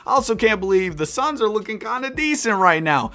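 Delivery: ordinary talk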